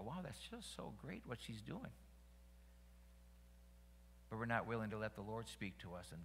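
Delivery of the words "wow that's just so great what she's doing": soft mock admiration; hushed, idle bystander praise; passive admiration. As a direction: murmur it like an uninvolved admirer. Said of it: "hear this and go".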